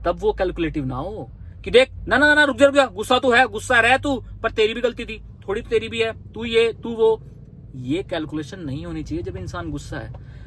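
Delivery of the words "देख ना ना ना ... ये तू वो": a partner lecturing instead of calming, heated and raised; calculating scolding. Retold or imitated. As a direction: scold them for their share of blame, raised voice. Partner lectures angry person.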